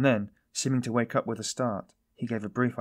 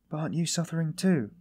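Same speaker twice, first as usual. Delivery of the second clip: high-pitched pleading question; heartfelt cry; 6 semitones higher than usual. A narrator's reading. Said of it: "cried Sonia".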